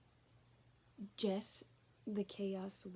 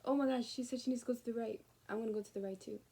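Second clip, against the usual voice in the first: higher, louder and fast; mock eager; 3 semitones higher, 7 dB louder, faster than usual. Voicing the person copying her, fast and eager. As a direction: rush it like a copycat. Acted follower quote.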